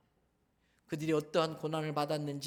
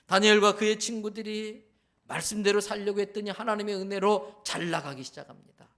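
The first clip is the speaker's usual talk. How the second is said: normal talking voice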